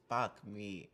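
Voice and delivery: normal talking voice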